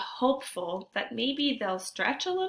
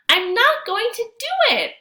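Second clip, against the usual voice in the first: much higher and louder, insisting; determined outburst; 11 dB louder than usual. Acted. Jeremy insists to himself while racing Antonio, loud and high. Boy's resolve peaks, racing.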